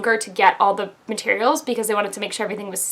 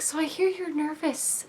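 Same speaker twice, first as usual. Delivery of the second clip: soft gentle nurse voice; 6 semitones higher, 12 dB quieter than usual. Retold or imitated. Radiologist's soothing words quoted.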